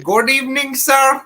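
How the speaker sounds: high-pitched mock Habib accent. Gamer greeting the chat as a running gag, Habib accent. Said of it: "with the Habib accent".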